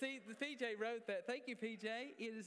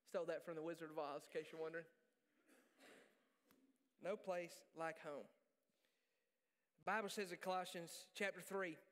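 usual speaking voice

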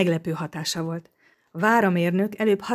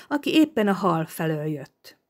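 ordinary delivery, nothing marked

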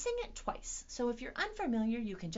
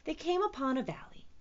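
ordinary delivery, nothing marked

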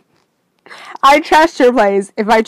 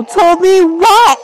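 louder, higher and slow, insisting hard; angry demand; 8 semitones higher, 6 dB louder, much slower than usual. Acted. Girlfriend demanding Owen explain himself, sharply angry. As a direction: press him for the answer, voice rising. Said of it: "Whoa, calm down".

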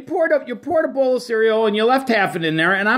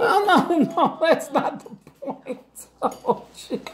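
laughing; 9 semitones higher, 4 dB quieter, slower than usual. Real, not acted. Laughing protest at table.

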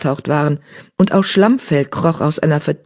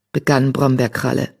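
plain reading voice

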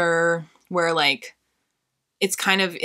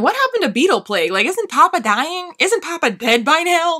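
incredulous; 11 semitones higher, 5 dB louder than usual. Retold or imitated. Retold reaction to the plot.

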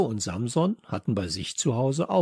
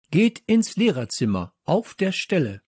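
high, loud, firm command; angry order; 6 semitones higher, 4 dB louder than usual. Acted. Teacher sending pupils to the staff room, angry command. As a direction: order them out crossly.

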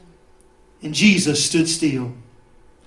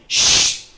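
long, forceful shush; 7 dB louder than usual. Acted. Devil and crowd silencing the one praying, shushing. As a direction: hush someone with a sharp hiss of air.

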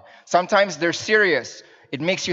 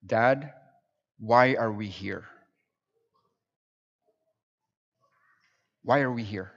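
lower, measured imitation of a son's earnest question; very serious child; 4 semitones lower, 4 dB quieter than usual. Young son asking Dad in church, very seriously. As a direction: ask it gravely, leaving room to think. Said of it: "asked me the question very seriously".

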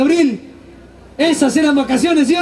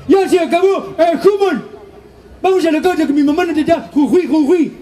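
dopey brother; 4 semitones higher than usual. Retold or imitated. Brother voiced in story. (voz de hermano bobo)

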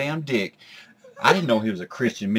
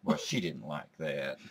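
deadpan; 6 semitones lower, 8 dB quieter, slower than usual. Acted. Running deadpan refrain.

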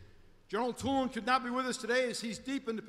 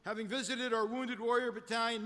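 ordinary talk, nothing marked

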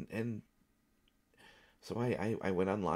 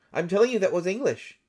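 loud, high protest; indignant insistence; 7 semitones higher, 9 dB louder than usual. Acted. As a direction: insist loudly, pitch pushed right up. Insisting after being challenged.

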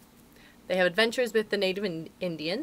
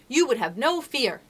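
raised, emphatic declaration; triumphant; 5 semitones higher, 4 dB louder than usual. A narrator's reading. Wendy assuring the children of mother's love, triumphant. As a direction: proclaim it with assurance. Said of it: "told them triumphantly".

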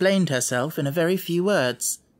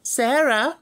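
high, louder headmistress call; schoolroom manner; 10 semitones higher, 4 dB louder than usual. A narrator's reading. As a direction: summon the pupil sternly. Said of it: "in her schoolroom manner".

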